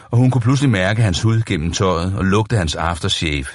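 ordinary delivery

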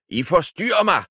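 high, loud wizard snapping at his slaves; sudden furious cry of a disturbed wizard; angry outburst. A narrator's reading. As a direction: burst out, fed up with the noise. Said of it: "udbrød troldmanden".

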